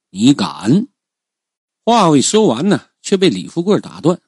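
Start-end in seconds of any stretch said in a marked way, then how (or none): 0.1-0.9 understated warning shout, pitch rising; sharp rebuke. Acted. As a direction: challenge him with a quick rebuke. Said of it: "我连忙喝道". (急忙喝止)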